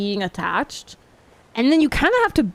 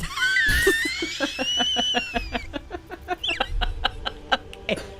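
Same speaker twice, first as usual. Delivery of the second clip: high shrieking laugh; 4 dB quieter than usual. Real, not acted. Big laugh.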